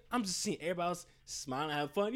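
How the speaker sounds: ordinary talk, nothing marked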